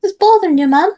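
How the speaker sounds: badly faked Polish accent, pitched high; high-pitched mock-European accent; terrible Polish accent. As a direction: put on a bad Polish accent. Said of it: "I say in my very terrible Polish accent".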